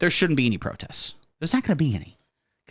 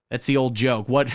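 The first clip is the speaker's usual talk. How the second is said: normal talking voice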